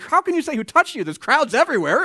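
high, loud, disbelieving protest; incredulous disciples. Acted. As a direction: scoff at him over the crowd noise.

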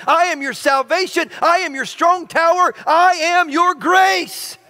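preaching build. Acted. Pastor building a litany of promises toward the church, preaching.

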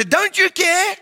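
high, loud desperate plea; accusing cry. Retold or imitated. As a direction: shout it up at Jesus as if betrayed. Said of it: "We go and say".

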